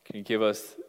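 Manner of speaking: low-key, measured closing prayer. Real, not acted. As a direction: pray softly, phrase by phrase. Closes the sermon in prayer.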